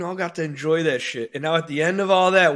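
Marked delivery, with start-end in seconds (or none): none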